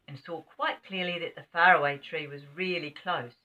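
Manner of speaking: ordinary delivery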